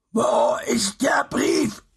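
slow, rasping, whispered monster demand; raspy creature voice. Acted. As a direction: hiss the question like a masked beast. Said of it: "fragte eine raue Stimme".

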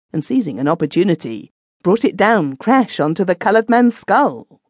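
usual speaking voice

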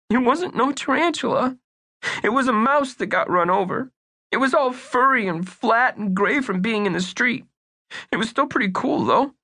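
put-on high, anxious young boy; earnest little boy. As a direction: play Bunky as a worried young kid. Bunky voice.

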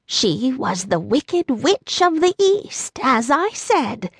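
a lightly voiced old lady; old woman. A narrator's reading. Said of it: "answered the little woman".

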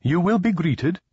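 high-pitched chirpy politeness; chirping alien guide. A narrator's reading. Indui guide reassures the guests, chirping high. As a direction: pipe it up high like a small alien. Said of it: "he chirped".

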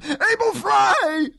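high, slow, drawn-out cry of terror; terrified scream. A narrator's reading. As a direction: scream the name in panic. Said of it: "Bolts screamed".

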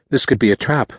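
wary Bryn. A narrator's reading. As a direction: warn him pointedly. Bryn warns Nick, suspicious.

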